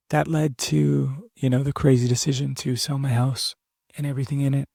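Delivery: normal talking voice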